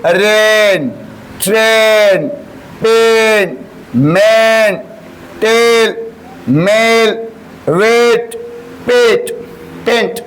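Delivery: each word drawn out clearly for the class; teacher modelling words. Shown as a demonstration. Teacher reads example words aloud to class, carefully articulated. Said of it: "जैसे".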